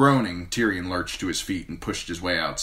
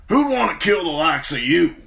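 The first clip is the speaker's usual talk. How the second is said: higher, louder rough sellsword; 11 semitones higher, 8 dB louder than usual. A narrator's reading. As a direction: play the cocky sellsword.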